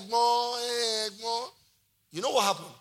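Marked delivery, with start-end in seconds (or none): 0.0-1.5 drawn-out, lightly acted plea; manager's retold plea. Manager answering an angry customer, drawn-out mock plea. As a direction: stretch the word out, imitating the manager.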